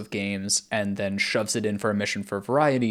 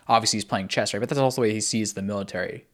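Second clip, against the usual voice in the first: normal talking voice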